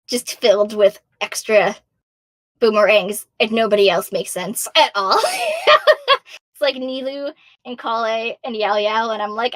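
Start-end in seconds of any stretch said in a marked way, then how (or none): 5.2-6.4 high bursting laughter; real laugh, higher. Real, not acted. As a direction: crack up at the chaos. Laughing at chaotic team builds.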